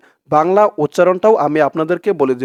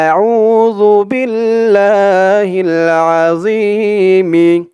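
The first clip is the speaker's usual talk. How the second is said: drawn-out chanted dua, a little louder; slow recitation; 3 semitones higher, 4 dB louder, much slower than usual. Shown as a demonstration. Teacher presents the dua, slow chanted recitation. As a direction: intone the dua slowly. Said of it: "দোয়াটি হচ্ছে".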